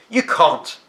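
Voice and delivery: loud, high angry retort; angry boy shouting at the gardener; angry outburst. Acted. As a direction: fling it out in anger. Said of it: "beginning to get angry".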